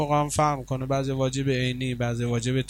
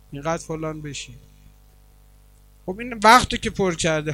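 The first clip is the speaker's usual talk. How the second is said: loud and higher, driving the point home; full-force preaching; 4 semitones higher, 5 dB louder than usual. Acted. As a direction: preach it out to the crowd.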